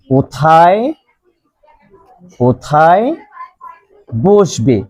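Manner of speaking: "কোথায়", "কোথায়", "বসবে" each alone, long pauses between; stretched "কোথায়", then a wait before each next word; word-by-word dictation. Shown as a demonstration. Teacher dictates question word by word.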